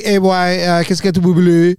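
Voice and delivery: imitating a Haitian friend calling out. Haitian friend on the phone, French Haitian accent. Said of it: "I had a friend who was Haitian".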